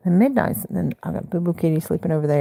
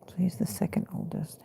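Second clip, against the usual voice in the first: whisper; 10 dB quieter than usual. Real, not acted. Whispered aside.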